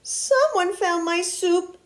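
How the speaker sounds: high squeaky little voice; slow, high whine of a small bear cub; Baby Bear. A narrator's reading. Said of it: "in a high squeaky voice".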